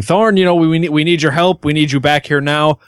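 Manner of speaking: pleading call, pitched high; yelling. Acted. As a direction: call him back, urgent and pleading. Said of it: "add to my yelling".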